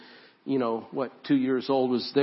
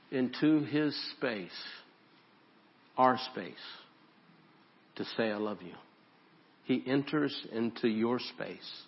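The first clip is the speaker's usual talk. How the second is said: tender hush; 4 semitones lower, 6 dB quieter than usual. Acted. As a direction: draw the room in, softer each line. Tender slow sermon climax.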